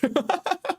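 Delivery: short high giggle; amused laugh. Real, not acted. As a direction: laughs at his own joke. Laughs at his own mockery.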